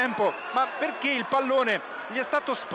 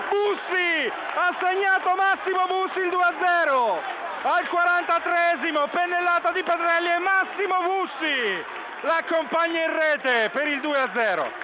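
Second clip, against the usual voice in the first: high, loud goal commentary; breathless italian goal commentary; 10 semitones higher, 4 dB louder than usual. Real, not acted. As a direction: shout it like a goal call.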